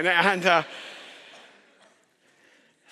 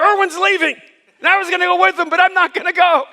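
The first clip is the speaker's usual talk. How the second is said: mock tattling little sister; 8 semitones higher, 6 dB louder than usual.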